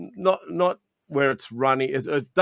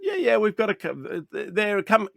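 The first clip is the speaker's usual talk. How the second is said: eager welcome; 7 semitones higher than usual. Retold or imitated. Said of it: "I said".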